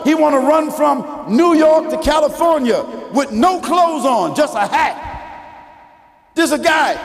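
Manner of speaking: high, ringing sermon with stretched key words; full-force preaching. Real, not acted. Preacher telling of a man running across America, full-force preaching. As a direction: call it out high to the whole congregation.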